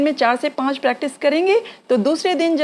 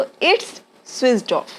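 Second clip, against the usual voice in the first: slow, it's run together; contracted it's slowly; much slower than usual. Shown as a demonstration. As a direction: squeeze it is into it's. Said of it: "it is को it's बोलते हैं".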